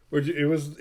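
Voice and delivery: usual speaking voice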